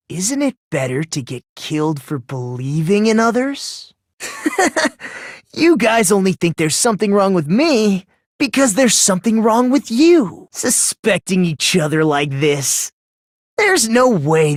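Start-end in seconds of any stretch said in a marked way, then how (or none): 4.2-5.3 a high unhinged little giggle; short crazed laugh pitched way up; manic giggle. Acted. Sudden laugh mid speech. 5.5-10.5 taunting accusation. Acted. Turns accusation back on them.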